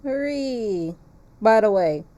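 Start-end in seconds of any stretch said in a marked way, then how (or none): none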